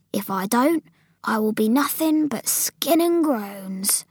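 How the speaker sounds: lightly voiced giant, drawn out in disgust; BFG grumbling. Giant explaining his snozzcumber diet to Sophie, grumbling. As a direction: grumble slowly, relishing each odd word.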